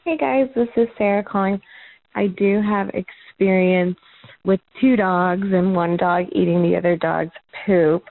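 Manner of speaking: ordinary talk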